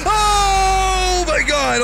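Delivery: very high, loud, drawn-out shriek; real startled scream. Real, not acted. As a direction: scream it out at a jump scare. Reacts to jump scare.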